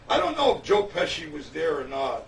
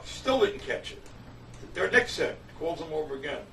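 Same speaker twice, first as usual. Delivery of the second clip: normal talking voice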